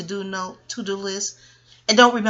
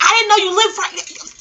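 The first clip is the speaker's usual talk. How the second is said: laughing teacher; 11 semitones higher, 6 dB louder than usual. Retold or imitated. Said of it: "She was like".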